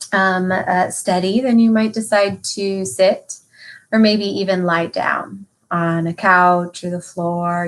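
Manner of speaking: ordinary talk, nothing marked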